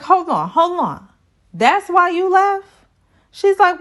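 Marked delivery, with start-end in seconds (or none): 0.0-2.7 incredulous. Retold or imitated. Zach's quote in retold argument.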